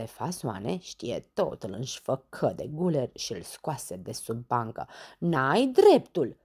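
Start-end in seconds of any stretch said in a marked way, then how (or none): 5.2-6.4 loud, slow, indignant shout; shouted protest. Acted. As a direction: shout it, stretching the words. (strigăt de protest, apăsat)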